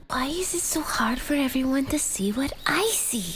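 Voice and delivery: hushed whisper to herself. Acted. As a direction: breathe it out as a secret. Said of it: "Nzinga whispered quietly to herself".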